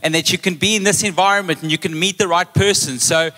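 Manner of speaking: high, insistent parent pleading; imitating nagging parents. Parents urge their student child to study, nagging. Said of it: "Even your parents are going".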